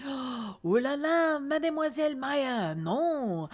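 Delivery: gasp, then a drawn-out, high French lament; hammed-up French melodrama, vowels stretched; terrible fake French accent. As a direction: wail theatrically in a cartoon French accent. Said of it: "my terrible fake French accent".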